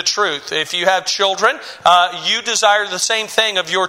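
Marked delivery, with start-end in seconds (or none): none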